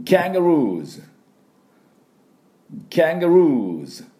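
high, loud teacher model キャンガルーズ with long stressed ルー; repeat-after-me キャンガルーズ; model pronunciation. Shown as a demonstration. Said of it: "私の続いて発音いきましょう".

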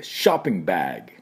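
ショッピングバッグ as English "shahping baeg"; American shopping bag. Shown as a demonstration. Teacher modelling for learners, ショッピングバッグ in American English. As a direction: open "shah", bare g ending in ショッピングバッグ. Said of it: "私の後ついていきましょう".

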